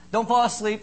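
ordinary delivery

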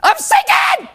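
high-pitched panicked yell; crying out in distress. Acted. Peter sinking in the waves, crying out in panic. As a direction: scream for rescue, hammed up. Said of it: "he, he cried".

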